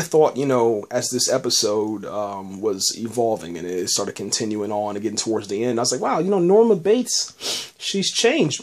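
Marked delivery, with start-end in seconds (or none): 6.0-8.6 higher, drawn-out wow of admiration; impressed. Retold or imitated. Viewer reacts to Norma's apparent change, impressed. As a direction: marvel at how someone changed.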